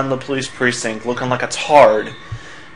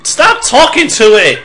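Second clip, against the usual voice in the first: high, loud outburst; exasperated shout; 11 semitones higher, 8 dB louder than usual. Acted. Player yelling at the game to stop, exasperated shout.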